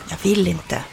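plain reading voice

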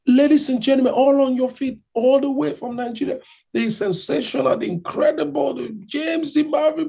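big build-up introduction, pitch climbing to the name; stage announcer. Retold or imitated. Man hypes an unknown singer to the crowd, announcer imitation. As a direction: imitate a hype MC in a high voice.

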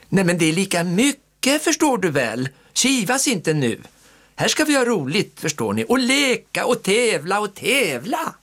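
high, lively aunt voice; rally the children; cheerful aunt. A narrator's reading. Aunt cheering the children on, high and lively.

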